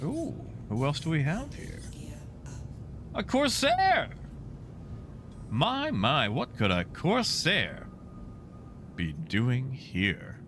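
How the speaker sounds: playful old-timey villain, lightly voiced; a hint of old-timey theatrical menace. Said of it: "in an old-timey voice".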